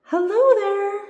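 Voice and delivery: high, loud friendly holler; loud, high-pitched hello. A narrator's reading. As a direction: call a greeting across a field. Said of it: "she hollers as she bounces off".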